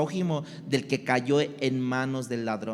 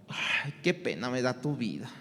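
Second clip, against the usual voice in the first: mock pity; 4 semitones lower, 6 dB quieter than usual. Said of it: "la compasión no es como".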